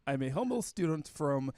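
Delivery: slow, hammed-up Russian accent. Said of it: "try to read your stereotypical Russian accent".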